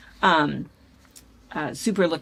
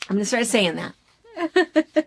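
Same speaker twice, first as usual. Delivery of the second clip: laughing; 4 semitones higher, 4 dB louder, slower than usual. Real, not acted. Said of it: "laugh heard".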